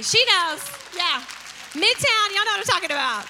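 loud, high call to the crowd; rousing call-out. Real, not acted. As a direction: call out for the congregation's agreement. Rallying the congregation to agree.

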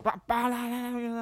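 high, silly nonsense babble; mocking gibberish. Man rambling nonsense, high silly babble. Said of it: "he's just like".

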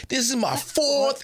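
"fourth" squeaked very high and slow; mad rapper voice. Retold or imitated. Rapper mocking the skit, saying "fourth" squeaky high and slow. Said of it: "He's like".

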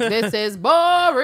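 high, slow, loud bored complaint; bored whine. Retold or imitated. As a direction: drag it out like a bored teen. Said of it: "she's like".

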